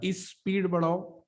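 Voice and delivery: स्पीड drawn out slowly, s cluster spelled out; slow teacher demo of स्पीड; slow pronunciation demo. Shown as a demonstration. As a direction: say स्पीड बढ़ाओ slowly, sound by sound. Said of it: "स पे focus कम है, ई पे ज्यादा".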